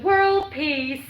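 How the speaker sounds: lightly voiced beauty queen; pageant contestant. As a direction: chirp it like a pageant answer. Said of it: "Ain't that what Miss Universe always says?".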